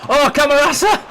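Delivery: high, loud cry of the name; excited shout. Real, not acted. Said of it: "That got me really excited".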